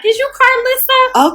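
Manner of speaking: put-on high-pitched quizzical girl voice; imitating a curious young girl; daughter's question. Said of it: "Her daughter walked up on me and was like".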